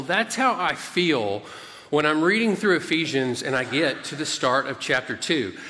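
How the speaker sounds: normal talking voice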